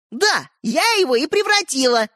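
high, gleeful confession; amused boast. A narrator's reading. Sister admits transforming the toy, amused. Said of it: "засмеялась Ариша".

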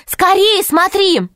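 high, urgent call; urgent shout. A narrator's reading. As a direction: call out fast to look. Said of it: "Алиса крикнула".

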